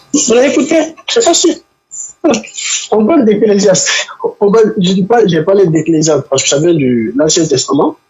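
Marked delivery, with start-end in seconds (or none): none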